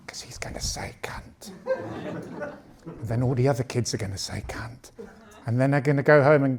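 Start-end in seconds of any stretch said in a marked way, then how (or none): 0.1-4.9 hushed dread whisper. Acted. Comic dad to the crowd, dread whisper. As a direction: whisper it as if afraid to be overheard.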